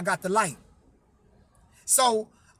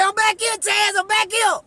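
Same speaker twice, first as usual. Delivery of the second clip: higher, louder voice calling from a back room; uncle calling back; 10 semitones higher, 3 dB louder than usual. Retold or imitated. Uncle answering from the back, raised higher voice.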